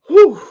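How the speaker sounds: a loud, high whew, letting the feeling out; shaky relief at the comments, a loud high whew; big emotional exhale. Real, not acted. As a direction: blow out the emotion in one loud high breath. Overwhelmed by emotional live comments.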